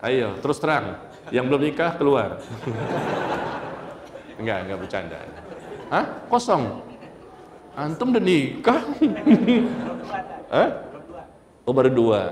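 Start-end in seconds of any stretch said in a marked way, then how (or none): 2.4-3.9 soft, voiceless laugh; low amused chuckling; real chuckle. Real, not acted. Laughs after joking with the audience. 8.9-10.5 high, amused giggling; high-pitched chuckle; real laugh. Real, not acted. Laughs while teasing a listener.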